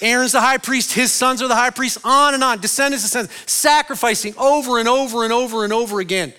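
high, loud, driving preacher voice; full-force preaching. Acted. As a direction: hammer it home from the pulpit.

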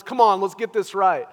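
a touch of exasperated urging; mock frustration. Preacher showing what Jesus is not saying, mild mock frustration. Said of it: "Not his anger, not his frustration".

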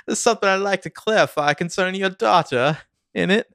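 high-pitched comic Cockney; mock-formal complaint in exaggerated Cockney; Cockney accent. English Ezra addressing the father, Cockney accent. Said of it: "started doing a Cockney accent".

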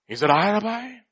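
softly feigned innocent question; unhurried, mild mock innocence; Judas pretending. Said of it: "He was pretending so well".

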